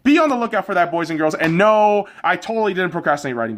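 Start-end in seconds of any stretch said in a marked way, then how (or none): none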